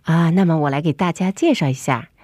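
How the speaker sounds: ordinary talk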